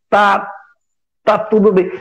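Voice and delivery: imitated hesitation, a long pause after the first word; mock a wavering "I'm fine"; halting answer that almost doesn't come out; faltering reply. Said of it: "vacilou entre".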